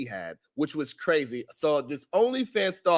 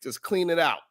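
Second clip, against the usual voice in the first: ordinary talk, nothing marked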